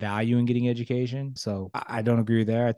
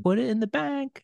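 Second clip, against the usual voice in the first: high-pitched grandmother voice; affectionate high-voiced granny imitation; imitated grandmother; 12 semitones higher than usual. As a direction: quote grandma in a raised, singsong pitch. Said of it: "she gave me some cash and she said".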